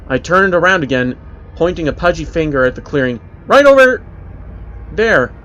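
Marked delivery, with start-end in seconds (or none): 3.4-5.3 put-on loud high child shout; calling out. A narrator's reading. Child shouting to mother where the creature was, loud. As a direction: shout it, pointing hard.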